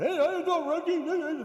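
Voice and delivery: high, loud goofy cartoon moose voice; Bullwinkle. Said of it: "this is how Bullwinkle talks".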